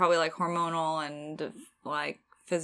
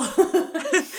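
laughing taunt; 11 semitones higher, 6 dB louder than usual. Pretend. End of the mock taunt.

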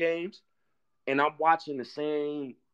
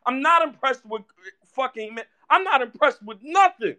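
raised, pitched-up ranting at the team; loud high rant; 10 semitones higher, 5 dB louder than usual. Real, not acted. Fan yelling at his own team about the preseason, loud and high. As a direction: let the frustration climb and spill out.

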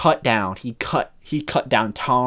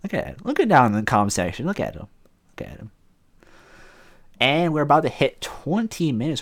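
normal talking voice

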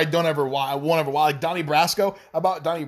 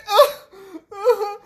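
loud laugh; 7 dB louder than usual. Real, not acted. Laughs at his own bit.

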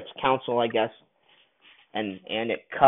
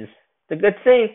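insisting; 9 semitones higher, 7 dB louder than usual. Real, not acted. Insisting to Mom it's good.

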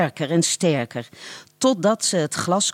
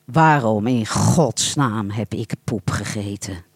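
booming inner voice; 8 semitones lower than usual. Acted. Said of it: "dreunde het tegen zijn trommelvliezen".

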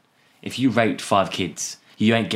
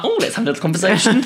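high, quick self-mocking quote; mock-eager; 12 semitones higher, 4 dB louder, faster than usual. Storyteller mocking himself for winding the window down, mock-eager. Mocks own foolish decision in story.

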